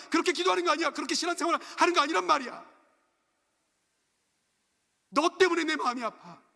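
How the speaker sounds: loud, high, pleading outcry; anguished divine rebuke. Acted. God begging a misguided servant to stop, anguished shouting. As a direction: hammer out each prohibition as God's desperate appeal.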